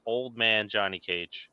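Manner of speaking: ordinary delivery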